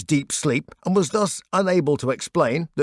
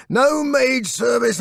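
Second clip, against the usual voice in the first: groaned; 10 semitones higher, 3 dB louder, slower than usual. Retold or imitated. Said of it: "I groaned".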